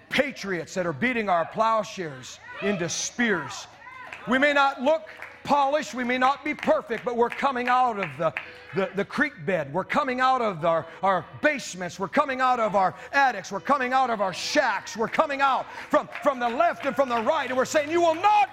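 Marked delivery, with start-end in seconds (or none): 4.3-17.9 preaching at full force. Acted. 17.9-18.5 high, loud, defiant proclamation; defiant shout. Pretend. Defiant declaration to enemies.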